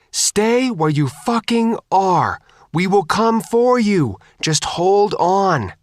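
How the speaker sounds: high, loud, slow call across water; loudhailer call. A narrator's reading. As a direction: call out slowly over a loudhailer. Calling over the loudhailer.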